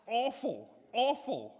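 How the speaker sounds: awful awful both high and loud, falling; emphatic awful. Real, not acted. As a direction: lean hard on each awful.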